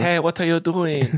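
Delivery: put-on high, slow, failed European accent; a slow, high stab at a European accent. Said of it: "No, that's not right".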